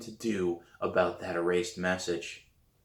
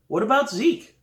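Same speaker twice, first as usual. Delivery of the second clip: high, louder, perky question; hopeful kid asking; 13 semitones higher, 6 dB louder than usual. A narrator's reading. Sam to Scott about jazz band, high and hopeful. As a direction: toss out the idea, voice lifted high. Sam suggests another friend.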